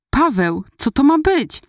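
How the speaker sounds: alarmed question leaping up high; horrified. A narrator's reading. Girlfriend confronted with something on her finger, horrified. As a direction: blurt it out, aghast at what you see. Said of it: "wykrztusiłam niemalże krztusząc z przerażenia".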